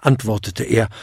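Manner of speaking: plain reading voice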